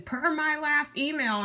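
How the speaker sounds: clipped passive aggressive email voice. Pretend. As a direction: type it in all caps out loud. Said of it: "in all caps".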